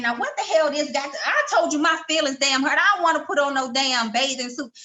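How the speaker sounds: high-pitched, fussy retold objection; worked-up inner grumbling, played for effect; exasperated protest. Retold inner protest at friend's order.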